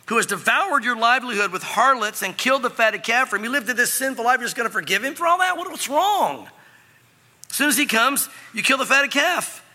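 high, indignant outburst; angry older brother. Acted. Older son accusing his father of unfairness, outraged. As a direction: pour out the accusation, pitch climbing. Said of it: "But he was angry".

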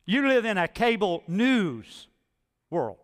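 ordinary talk

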